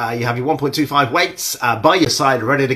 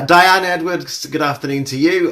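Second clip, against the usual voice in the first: put-on Australian accent; 5 semitones higher, 6 dB louder than usual. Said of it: "an appalling Australian accent that was".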